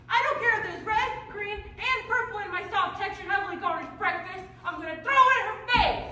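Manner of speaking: high, loud furious rant; angry rant. Acted. Stepsister ranting about breakfast.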